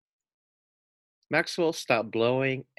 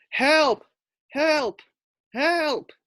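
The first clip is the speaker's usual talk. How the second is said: much higher, louder screams; cries for help; 5 dB louder, much slower than usual. A narrator's reading. Sinking pig screaming for rescue, high and loud.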